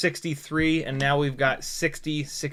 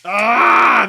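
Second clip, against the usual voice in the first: loud, high exasperated yell; frustrated shout; 6 semitones higher, 12 dB louder than usual. Real, not acted. Pilot fed up with the cube drop, loud frustrated yell. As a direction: let out an annoyed shout.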